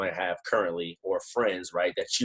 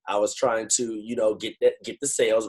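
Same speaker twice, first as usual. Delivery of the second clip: usual speaking voice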